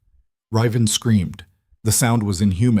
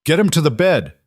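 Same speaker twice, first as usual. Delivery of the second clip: higher, louder urgent command; order; 8 semitones higher, 4 dB louder than usual. A narrator's reading. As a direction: bark the order fast. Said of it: "Corvin ordered".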